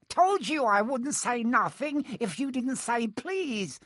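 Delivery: high, slow gloating chant; Peeves, annoying sing-song. A narrator's reading. Peeves having tricked Filch, gloating sing-song. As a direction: gloat in a drawn-out high lilt. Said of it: "his annoying sing-song voice".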